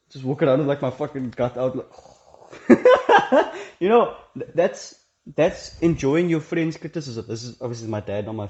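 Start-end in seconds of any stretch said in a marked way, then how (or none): 2.5-3.7 high, loud burst of laughter; real laugh. Real, not acted. Telling viewers about his dad, bursting into loud laughter. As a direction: crack up at your own joke.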